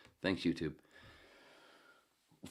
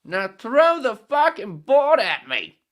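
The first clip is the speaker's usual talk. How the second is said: very loud, high, slow Aussie punchline bellow; Australian accent; 23 dB louder, much slower than usual. Acted. Head yells the punchline at the bartender, bellowing Australian accent. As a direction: roar the last line up high in a brash Australian voice. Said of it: "Australian accent keep going into British".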